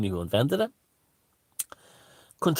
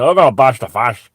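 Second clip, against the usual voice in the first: loud working-class Dublin voice; Dublin accent; 9 dB louder than usual. Acted. Comedian testing the slogan in a loud Dublin accent. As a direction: punch it out in Dublin tones. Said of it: "in a Dublin accent".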